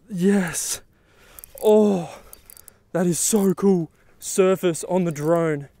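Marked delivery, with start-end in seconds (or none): 0.1-3.9 thrilled exclaim. Real, not acted. Huge fish surfaces on camera.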